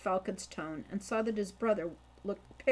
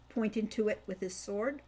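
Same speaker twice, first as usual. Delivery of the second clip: usual speaking voice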